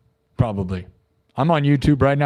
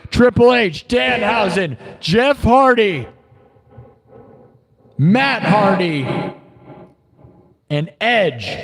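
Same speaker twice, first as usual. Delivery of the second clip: announcer-style callout; 7 semitones higher, slower than usual. Pretend. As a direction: belt each name out to the arena. Calling out wrestlers to fight.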